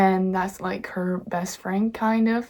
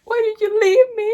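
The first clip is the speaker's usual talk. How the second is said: high, loud crying plea; mock-sobbing heartbroken girl; devastated cry; 8 dB louder than usual. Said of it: "Lily's, like, devastated".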